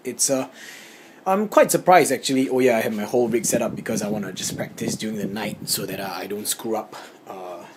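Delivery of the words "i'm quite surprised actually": surprised. Real, not acted. Surprised at the room.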